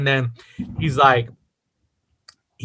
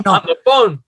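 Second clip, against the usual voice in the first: words riding out on a laugh; 5 semitones higher than usual. Real, not acted. Friend laughs at the three-way call remark, laughing. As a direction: laugh through the phrase, higher.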